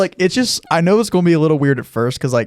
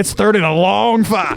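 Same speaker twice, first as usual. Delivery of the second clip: slow, high play-by-play call; sportscaster voice; 8 semitones higher, slower than usual. Pretend. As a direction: call it like a big football down. Mock play-by-play of the meeting.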